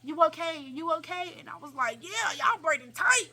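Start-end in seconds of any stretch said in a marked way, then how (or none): 0.0-1.3 lightly voiced higher-pitched check-in; lightly imitated concerned question; imitated braider. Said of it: "The lady kept asking me". 2.0-3.3 high, drawn-out mock complaint; exaggerated slow grievance; acted complaint. Acted reply to braiders.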